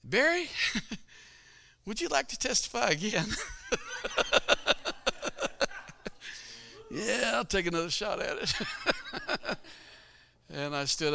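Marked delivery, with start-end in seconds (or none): 0.0-3.3 teasing pastor. Retold or imitated. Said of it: "he said". 3.7-9.7 laughing. Retold or imitated.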